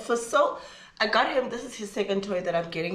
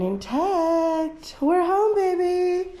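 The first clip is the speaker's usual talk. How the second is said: high, drawn-out happy cry; sing-song joy; 10 semitones higher, 4 dB louder, much slower than usual. Real, not acted. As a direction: sing it out, relieved to be home. Arriving home, high pet voice.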